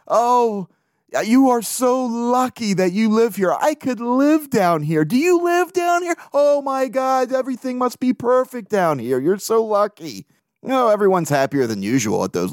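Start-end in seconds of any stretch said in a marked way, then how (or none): 0.1-10.3 high, slow, gushing tourist voice; much higher, drawn-out gush of a drunk tourist. Retold or imitated. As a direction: coo like a tipsy tourist envying the bartender. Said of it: "they all say the same shit to the bartender".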